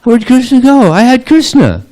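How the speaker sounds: very high, loud bewildered wail; acted shock. Gopi finding Krishna gone, shocked outcry. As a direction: squeal it in mock disbelief.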